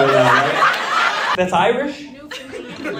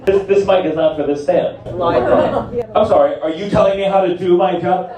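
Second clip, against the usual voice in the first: usual speaking voice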